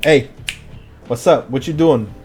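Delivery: usual speaking voice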